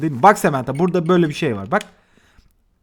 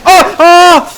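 loud high scream of fright; real yelp of shock; startled scream; 13 semitones higher, 12 dB louder than usual. Real, not acted. Player jumping at a sudden scare, screaming.

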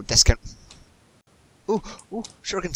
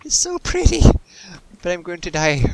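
squeaky high mock-naive voice, much louder; mock friend; 5 semitones higher, 8 dB louder than usual. Mocking how his friend will sound exploring the Aether, in a high squeal. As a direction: parody a dazzled newbie player, going high and loud. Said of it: "run around going".

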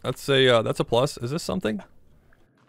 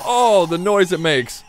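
drawn-out, higher-pitched recoil; creeped-out exclamation; 4 semitones higher, slower than usual. Real, not acted. Player recoiling from a creepy baby creature's noise, creeped out. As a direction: groan it out slowly in dismay.

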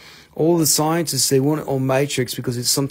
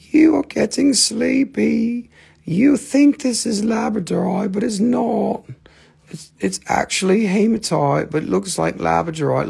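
hypnotist voice; 8 semitones higher than usual. Pretend. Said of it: "It's like".

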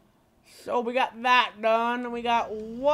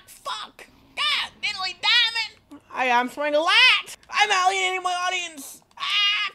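high, loud exclamations; frantic outburst; 9 semitones higher, 6 dB louder than usual. Real, not acted. Streamer alienating the audience mid-fight, frantic yelling. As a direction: yell it in a flustered burst.

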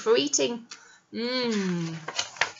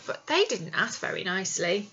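usual speaking voice

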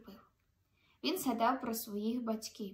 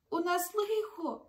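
worried snail; 6 semitones higher than usual. Acted. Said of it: "сказав равлик".

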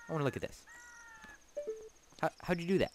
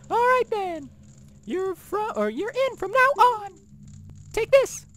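high, put-on voice of a haughty desert guard. Guard welcoming the hero, in a high put-on voice. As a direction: play the proud thief lieutenant, held high throughout.

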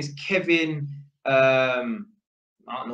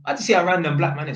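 normal talking voice

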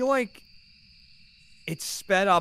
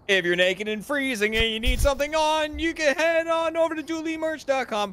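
much higher sing-song pitch; improvised merch jingle; 11 semitones higher than usual. Pretend. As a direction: sell it like a radio jingle. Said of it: "make a jingle right now".

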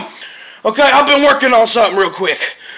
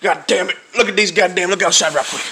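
usual speaking voice

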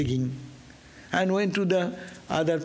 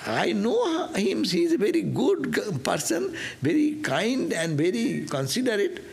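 incredulous wife; 7 semitones higher than usual. Retold or imitated. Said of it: "She said".

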